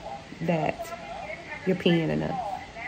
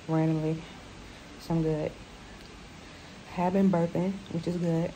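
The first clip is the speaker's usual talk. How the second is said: weak and hurting; 9 dB quieter than usual. Real, not acted. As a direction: speak quietly in short bursts with long pauses. In pain after surgery, sore throat.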